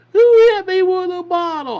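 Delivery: very high, loud screech; high-pitched hammed-up holler across the bar; mock outraged yell. Retold angry woman yelling at bar.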